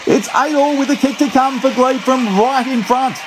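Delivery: high, fast, frantic try call; radio rugby commentator. Real, not acted. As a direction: scream the try home to listeners. Try scored, commentator erupts.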